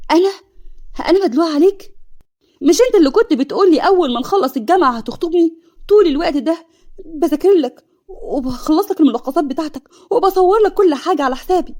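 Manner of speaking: high, loud, indignant protest; hurt outrage. Acted. Hanin angrily confronting Ahmed who used her. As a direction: push back hard, voice up high.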